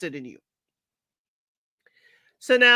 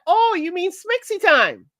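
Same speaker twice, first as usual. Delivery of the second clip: higher teasing mock question; mock Titus; 9 semitones higher, 3 dB louder than usual. Titus teasing Brianna about making out, high mock tease. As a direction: tease her like a grown man amused. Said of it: "He's like".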